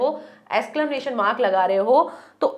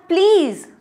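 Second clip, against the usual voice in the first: "Please" higher, louder, falling hard; raised fed-up please; exasperated please; 5 semitones higher, 4 dB louder, much slower than usual. Shown as a demonstration. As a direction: beg to be spared. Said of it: "एक आप बोल रहे हो".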